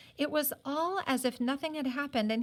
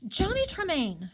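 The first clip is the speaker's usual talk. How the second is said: high, loud exclamation of his name; crying out; 8 semitones higher, 4 dB louder than usual. A narrator's reading. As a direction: call his name in shock. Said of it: "she cried".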